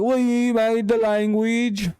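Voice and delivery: very high, slow mocking British voice; hammed-up British whine, sustained high pitch; mock British accent. Streamer imitating Brits defending their language, high mock British accent. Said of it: "They're like".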